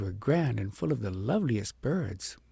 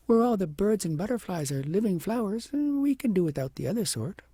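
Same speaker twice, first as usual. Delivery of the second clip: musing princess; 7 semitones higher than usual. A narrator's reading. Princess reasons to herself.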